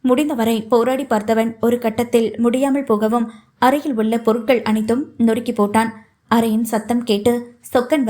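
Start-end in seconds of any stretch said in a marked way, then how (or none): none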